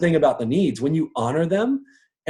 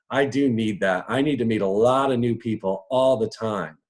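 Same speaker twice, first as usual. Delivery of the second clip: usual speaking voice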